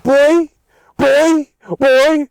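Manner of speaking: high, punchy mock shout, word by word; re-enacted angry shout. Worker confronting coworker who called him boy, high angry shout. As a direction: fire it off loud and high.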